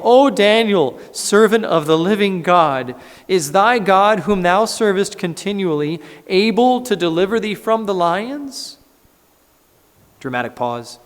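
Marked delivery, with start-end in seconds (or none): 0.0-8.8 loud, high anguished calling out; lamentable cry. Acted. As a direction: cry out to Daniel in anguish from the den. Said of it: "he cried with a lamentable voice".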